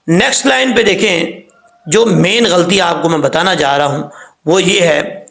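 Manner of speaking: ordinary delivery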